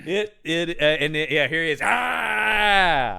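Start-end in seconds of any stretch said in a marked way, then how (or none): 1.3-3.2 mock scream.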